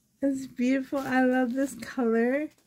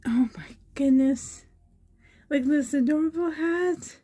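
higher-pitched, awestruck gushing; thrilled amazement; 3 semitones higher than usual. Real, not acted. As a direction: marvel at a gift you adore, voice lifted. Thrilled unboxing of baby outfit.

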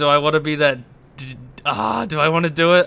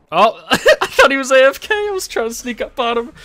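loud, high, caught-out exclamation; startled outburst; 11 semitones higher, 7 dB louder than usual. Real, not acted. Player caught sneaking up on rival, startled outburst. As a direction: blurt it high, caught off guard.

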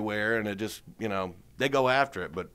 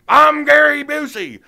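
much higher, loud, slow intense voice; 12 dB louder, slower than usual. Retold or imitated. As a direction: introduce yourself like an overexcited wild man. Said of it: "and he goes".

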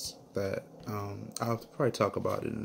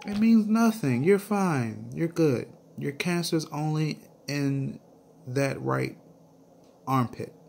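higher, louder cheerful reassurance; the doctor brushing off their fear, upbeat; reassuring doctor; 6 semitones higher, 4 dB louder than usual. Retold or imitated. Doctor reassures crying couple.

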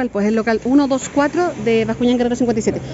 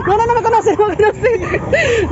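laughing; 9 semitones higher, 3 dB louder, slower than usual. Real, not acted. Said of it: "laughing denial".

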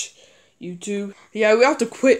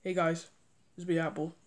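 a lightly voiced plush toy, softer; Apple plush voice; 3 semitones lower, 12 dB quieter than usual. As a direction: hush the greeting down as a small plush toy. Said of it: "And I do Apple's voice".

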